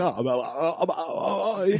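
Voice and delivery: mock stammer, lost for words; flustered stammer. Friend imitating the stunned stranger, flustered stammer. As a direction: imitate a man fumbling for an answer, quietly. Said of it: "didn't know what to say".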